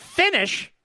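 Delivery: a baffled double take at the news; mock disbelief at the result; surprised.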